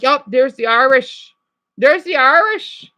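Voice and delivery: her natural lilt read as Irish; an accidental Irish lilt, rising at the end; Irish accent. Retold or imitated. Irish accent to chat viewers. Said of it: "It sounds like I'm saying it, the accent".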